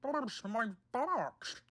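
high, slow underwater exclamation; bubbling exclamation. A narrator's reading. Boko has slid into the sea, bubbling. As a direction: exclaim it slowly as if bubbling in water. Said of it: "exclaimed Boko".

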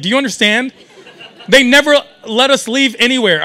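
ordinary talk

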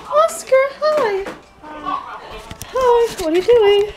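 high squeaky voice for a pet; sing-song high greeting to a pet. Real, not acted.

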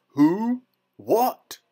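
loud, high startled roar; giant's bellow. A narrator's reading. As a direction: bellow as a giant jolted awake. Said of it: "bellowed the giant".

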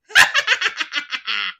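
high, loud evil cackle; wicked witch cackle. Acted. Witch gloating over the children, loud evil cackle. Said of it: "she laughed wickedly".

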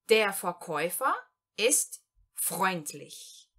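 Der Verkäufer ist freundlich, very slowly with pauses; slow German example. Shown as a demonstration. Teacher reads the example sentence to learners, very slowly. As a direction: dictate the sentence slowly in two parts. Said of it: "Primeiro exemplo".

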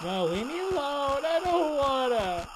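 high, loud, stretched-out pleading; panicked wail. Real, not acted. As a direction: wail it as the chaser closes in. Enemy is catching up.

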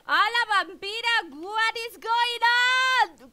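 loud, high, stretched-out shriek of surprise; excited shout. Real, not acted. Streamer shrieks at a surprise donation, loud and drawn out. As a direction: yell it out, floored by the donation. Huge donation from Vampira arrives.

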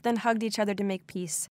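usual speaking voice

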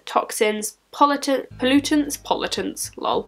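stiff lips from dried clay; talking with tight facial skin; through a dried face mask. Real, not acted.

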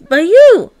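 YOU thrown high and loud; emphatic YOU. Acted. Guide revealing who set the goal, stress on YOU. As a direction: punch the word YOU with a big rise and fall.

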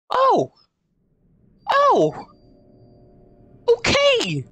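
high, startled cries; shocked yelps. Real, not acted. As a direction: yelp in shock. Shocked at allies buried alive.

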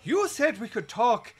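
imitating the girlfriend's complaint. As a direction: pitch it up as the protesting girlfriend. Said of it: "And she says".

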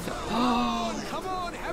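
drawn-out, high gasp of wonder; long gasp. Pretend. Gamer spots items they want for sale, long gasp. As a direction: suck in a long excited breath. Gasps on spotting items wanted.

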